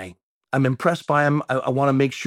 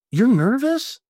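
surprised; 10 semitones higher, slower than usual. Retold or imitated. Pastor surprised Charlie is nervous.

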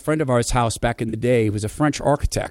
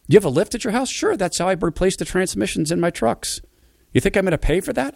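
high surprised question; can't believe he owns a lift; incredulous; 9 semitones higher than usual. Retold or imitated. Incredulous exchange about the lift.

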